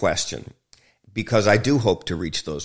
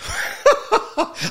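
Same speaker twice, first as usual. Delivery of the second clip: sudden high unvoiced laugh; laughing at the thought of admitting it; high burst of laughter; 3 dB louder than usual. Real, not acted. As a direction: break into a laugh. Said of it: "I'm laughing".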